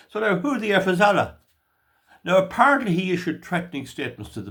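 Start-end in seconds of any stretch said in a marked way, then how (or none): 0.4-1.4 high mock chant; retold shout. Man explaining the lad's joke on the song, raised mock shout. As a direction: call it out like the altered disco refrain. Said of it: "One lad shouted".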